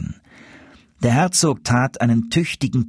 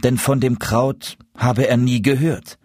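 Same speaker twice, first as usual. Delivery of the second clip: ordinary delivery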